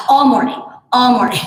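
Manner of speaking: imitating a son's long dramatic sigh; mock sigh. Mom imitating her sighing son, mock sigh. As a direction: sigh it out like a moping eight-year-old. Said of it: "He is sighing into his breakfast".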